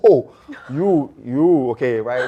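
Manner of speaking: mock disbelief. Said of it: "Then I was like".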